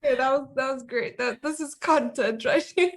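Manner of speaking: amused praise through laughter; laughing. Real, not acted. Guest praising the host's attempt, laughing.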